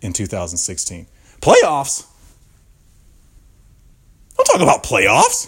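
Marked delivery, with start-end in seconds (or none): 1.4-5.5 high, loud squawk of disbelief; incredulous outburst. Acted. Storyteller mimicking a rant about playoffs, incredulous outburst. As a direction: exclaim it in mock outrage, voice jumping up.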